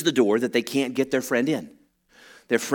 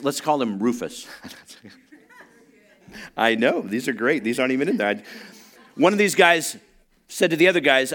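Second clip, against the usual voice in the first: usual speaking voice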